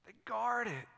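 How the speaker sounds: soft, weighty echo; quietly emphatic, well under preaching volume; hushed repeat. Real, not acted. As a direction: drop to a soft voice to let it sink in. Same line repeated hushed after pause.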